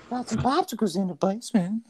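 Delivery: put-on high, creepy old man croon; Herbert voice. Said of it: "I could mimic that voice".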